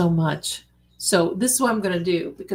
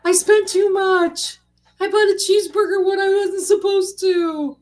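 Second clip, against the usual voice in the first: high mock voice of a nervous spender; 12 semitones higher, 4 dB louder than usual. A gambler confessing overspending in a squeaky, much higher voice. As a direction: play a guilty little voice confessing a cheeseburger. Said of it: "I'd be".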